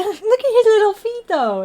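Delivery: high, cooing over tiny paws. Real, not acted. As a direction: coo at the little feet. Cooing over cat's feet.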